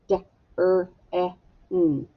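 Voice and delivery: D-R-E-N as four separate sounds; sounded out. Shown as a demonstration. Teacher tapping it out for the class, D-R-E-N sound by sound. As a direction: tap out D-R-E-N, a gap after each sound. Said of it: "tap it out".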